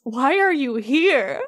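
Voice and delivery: slow, weary Beast question; annoyed Beast. Acted. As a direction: drag it out, fed up. Beast voiced, annoyed at guests.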